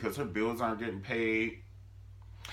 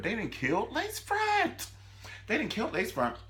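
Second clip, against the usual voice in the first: dramatic reveal; 14 semitones higher than usual. Real, not acted.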